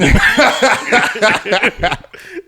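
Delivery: high, loud burst of laughter; big laugh. Real, not acted. Cracking up at his own mock voice, high loud laughing. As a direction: crack up loudly.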